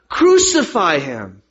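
slow, forceful mob cry; loud, insistent demand from the crowd. A narrator's reading. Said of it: "they shouted all the louder".

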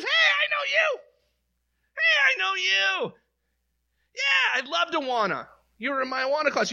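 put-on a little girl running up, thrilled to see you. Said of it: "little girl that runs up to you and says".

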